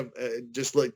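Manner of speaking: ordinary talk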